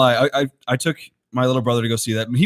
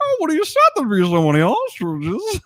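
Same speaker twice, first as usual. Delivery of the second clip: silly high voice; 9 semitones higher than usual. Pretend. Continuing a silly impression.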